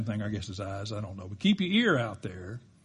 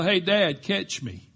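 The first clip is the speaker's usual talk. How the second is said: retold kid's yell; 7 semitones higher, 5 dB louder, slower than usual. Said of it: "I heard a voice from above me yell".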